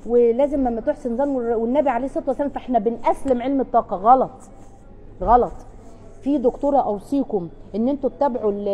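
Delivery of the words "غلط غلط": louder, firm rejection of the idea; emphatic no. Real, not acted. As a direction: say it like a hard stop. (رفض حازم بصوت أعلى)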